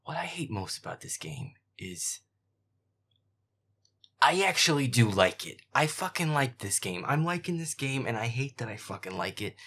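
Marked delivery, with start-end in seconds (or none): 4.2-9.6 loud, exasperated confession; frustrated outburst. Real, not acted. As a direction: shout it, half angry that you love it. Outburst of love-hate for game.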